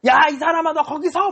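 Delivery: high-pitched acted angry call. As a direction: holler after someone to stop them. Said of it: "엄청 화가 나 있는 표정이에요".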